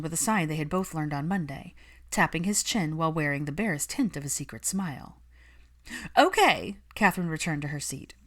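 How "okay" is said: put-on a touch higher, eager young witch; bright eager opener from the nanny. Catherine back at the table, lightly eager.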